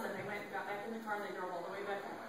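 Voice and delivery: quick hushed run; fast quiet rush. A narrator's reading. Family fleeing the hotel, told fast. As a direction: rattle it off quickly.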